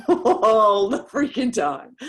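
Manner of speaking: laughing through the words; amused laugh about a blunt friend. Real, not acted. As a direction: let the laugh spill into the line.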